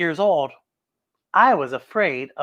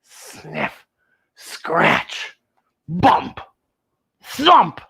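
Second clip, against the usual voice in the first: spooky monster noises; 2 semitones lower, much slower than usual. Retold or imitated. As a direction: build from a hushed sniff to a thump. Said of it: "I could hear it making noises".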